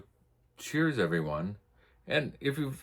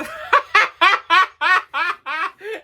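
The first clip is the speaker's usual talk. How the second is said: high cackling laugh; burst of laughter; 10 dB louder than usual. Real, not acted. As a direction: crack up at the punchline. Laughing at own story.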